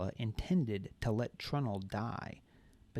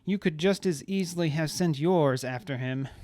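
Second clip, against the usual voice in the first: Marla voice; 5 semitones higher, 8 dB louder than usual. A narrator's reading. Marla retorts.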